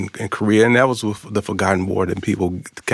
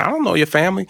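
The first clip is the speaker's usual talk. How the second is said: high, pleading objection; high-pitched retold protest; incredulous protest; 10 semitones higher, faster than usual. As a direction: push back in disbelief.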